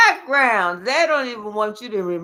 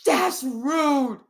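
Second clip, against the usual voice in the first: ordinary delivery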